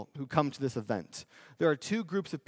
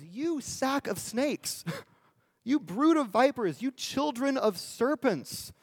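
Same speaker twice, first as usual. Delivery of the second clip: much higher, slow fiery prophet denunciation; John condemning; 9 semitones higher than usual. Retold or imitated. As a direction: rain scorn on them, drawing each phrase out. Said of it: "he says".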